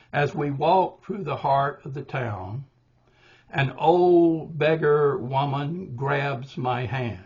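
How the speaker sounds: put-on slow, beats marked, old stressed; five-beat reading. Shown as a demonstration. Poet showing the five-beat line, slow and marked. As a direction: read an old beggar woman grabs my hand slowly on its beats. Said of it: "five beats".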